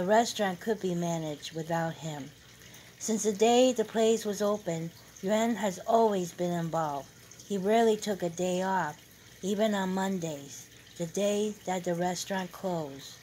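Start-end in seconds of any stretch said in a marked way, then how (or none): none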